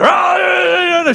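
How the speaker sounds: high imitated yells of outrage; mock screaming. Taxi driver yelling at the police, high mock yells. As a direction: shout the wordless cries way up high. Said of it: "shouting and screaming".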